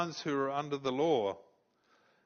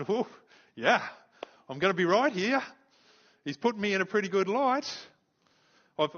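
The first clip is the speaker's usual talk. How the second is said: louder, higher, relieved and growing hopeful; relieved excitement; 4 semitones higher, 5 dB louder than usual. Retold or imitated. Defendant listens to his lawyer, rising in relieved excitement. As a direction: hear the good words pile up and grow elated. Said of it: "and I'm going".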